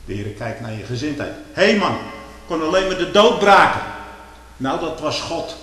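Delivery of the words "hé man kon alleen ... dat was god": full-force sermon climax; fiery preaching shout. Acted. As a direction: thunder it from the pulpit.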